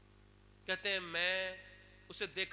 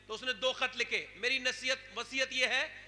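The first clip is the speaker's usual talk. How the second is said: rising preaching; 5 semitones higher, 10 dB louder than usual. Acted. Preaching rises again.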